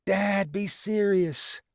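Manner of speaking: high, slow, pleading dad be serious; emotional son. A narrator's reading. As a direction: hold back tears begging dad. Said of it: "Lucky goes all emotionally".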